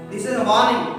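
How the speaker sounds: ordinary delivery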